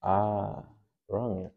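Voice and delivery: ordinary talk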